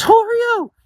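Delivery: very high-pitched screamed imitation of a woman; mock scream. Woman waking beside a stranger, mock scream. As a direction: shriek it as the startled woman. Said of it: "she screamed".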